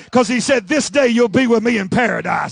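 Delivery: emphatic sermon climax; fiery preaching. Real, not acted. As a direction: drive it home to the congregation. Preaches the climax at full force.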